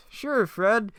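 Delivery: Shaggy. Acted. Shaggy telling Fred about the door, high impression. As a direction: answer in a squeaky put-on voice. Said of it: "back to Fred and Shaggy".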